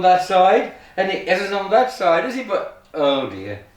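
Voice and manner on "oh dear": ordinary talk, nothing marked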